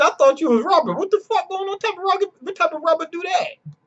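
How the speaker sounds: very high, squeaky bewilderment; mock incredulity. Sparrow asking what rubber does that, high mock incredulity. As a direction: climb into a high, stammering protest.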